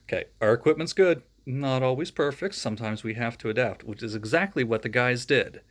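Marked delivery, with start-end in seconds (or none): none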